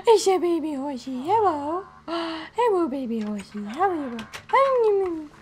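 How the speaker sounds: put-on high, gushing baby talk with gasps. Player greets a baby horse, in gushing baby talk. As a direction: coo to a baby animal, swooping high.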